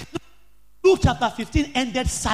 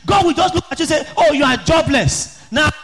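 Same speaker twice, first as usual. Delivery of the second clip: loud, higher preaching with sung cadence; fiery preaching; 3 semitones higher, 3 dB louder than usual. Acted. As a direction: preach it to the congregation at full force. Preaching at full force.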